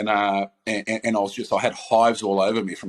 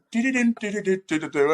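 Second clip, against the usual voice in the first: high da-da-dum ringtone hum; hummed Beethoven ringtone; 9 semitones higher than usual. Retold or imitated. Player mimicking the doctor's phone, humming a ringtone. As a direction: imitate the phone ringing with a tune. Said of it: "ringing like a Beethoven ringtone".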